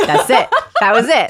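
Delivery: loud, high laughing agreement. Real, not acted. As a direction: agree through a burst of laughter.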